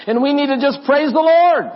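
loud, high, rousing praise shout; hammed-up preacher voice. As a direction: proclaim it like an over-excited preacher. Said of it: "maybe it's the Tony Miller voice".

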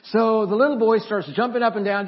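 ordinary talk, nothing marked

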